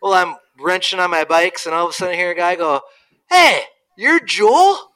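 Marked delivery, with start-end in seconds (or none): 3.3-4.9 high, loud, drawn-out hail; retold shout of recognition, stretched out; calling out. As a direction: imitate a stranger hollering over slowly. Retold stranger calling out to him.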